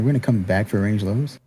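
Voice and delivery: normal talking voice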